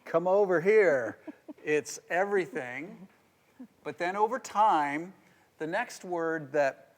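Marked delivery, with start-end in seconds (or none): 0.0-1.2 smitten call. Retold or imitated. Newlywed husband calling to wife.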